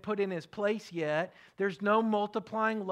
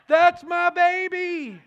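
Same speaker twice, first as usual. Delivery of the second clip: much higher, louder, slow proud yell; a dad proudly yelling out his child's nickname in public; 10 semitones higher, 12 dB louder, much slower than usual. Shown as a demonstration. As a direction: shout it for the whole room to hear. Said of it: "Find a public moment to yell out".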